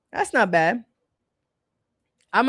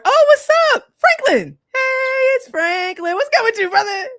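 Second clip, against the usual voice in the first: much higher, loud delighted hello; excited greeting; 5 dB louder, slower than usual. Real, not acted. Greeting Franklin who joined the live, thrilled and loud.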